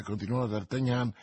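ordinary delivery, nothing marked

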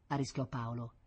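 ordinary delivery, nothing marked

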